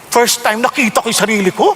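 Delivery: normal talking voice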